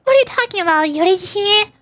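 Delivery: high, flustered little companion; excitable Paimon. Acted. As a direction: protest in a high, indignant squeak. Voices Paimon in game dialogue.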